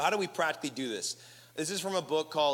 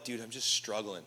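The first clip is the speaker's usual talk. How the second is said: weary confession; 7 semitones lower, 6 dB quieter than usual. Retold or imitated. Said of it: "You're like".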